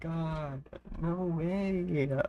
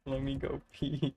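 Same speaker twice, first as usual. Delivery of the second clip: usual speaking voice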